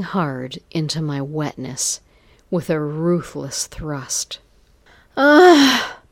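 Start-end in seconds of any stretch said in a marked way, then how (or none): 5.2-6.0 high, loud whimpering moan; whimpered cry. A narrator's reading. Narrator voices her own whimper at the thrust, high loud cry. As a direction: let out a sudden loud, high "ah". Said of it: "I whimpered".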